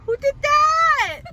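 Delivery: high, slow, loud imitation of mom; mock surprised mom. Son retells mom's reaction, high, slow and loud. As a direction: playfully mimic her confused question. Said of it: "She said".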